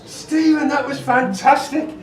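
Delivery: loud, high, drawn-out mock amazement; amazed praise. Acting out the producers' amazed praise.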